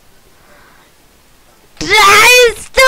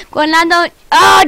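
usual speaking voice

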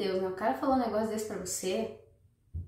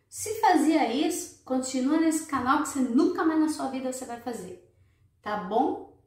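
ordinary talk, nothing marked

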